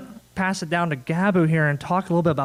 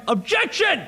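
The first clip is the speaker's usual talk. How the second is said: high-pitched mock courtroom yell; 12 semitones higher, slower than usual. Panelist jokes about yelling in a break room, mock yell. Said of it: "sitting in a break room and yelling".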